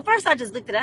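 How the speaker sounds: normal talking voice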